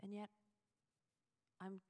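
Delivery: normal talking voice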